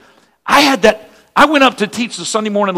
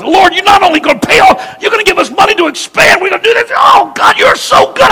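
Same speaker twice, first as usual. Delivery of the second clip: ecstatic praise; 10 semitones higher than usual. Retold or imitated. Pastor thanking God for two million dollars, rising ecstatic shouts. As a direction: climb higher with every line of thanks. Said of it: "I was so excited".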